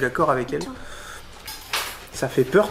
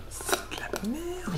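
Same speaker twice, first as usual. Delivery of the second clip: whispering; 14 dB quieter, much slower than usual. Acted. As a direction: breathe it out as a secret verdict. Comic punchline, dropped to whisper.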